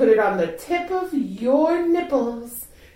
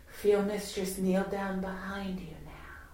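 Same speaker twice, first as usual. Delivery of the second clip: breathy close whisper; 11 dB quieter than usual. Acted. Intimate erotic roleplay.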